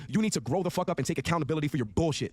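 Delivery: ordinary talk, nothing marked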